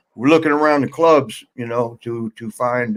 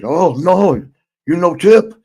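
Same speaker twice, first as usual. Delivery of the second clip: higher, a touch louder, protesting; mock yell; 5 semitones higher, 3 dB louder, slower than usual. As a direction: call after them about the tip. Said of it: "he yells".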